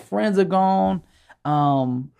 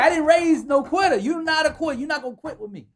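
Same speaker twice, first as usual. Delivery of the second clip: mama; 11 semitones higher than usual. Retold or imitated. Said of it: "She's like".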